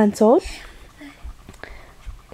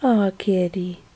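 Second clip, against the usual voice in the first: ordinary talk, nothing marked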